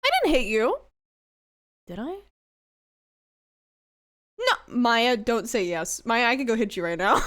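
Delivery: normal talking voice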